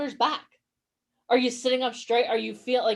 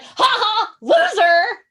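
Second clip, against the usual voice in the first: mock taunt; 9 semitones higher, 8 dB louder, much slower than usual. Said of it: "Alicia's gonna be like".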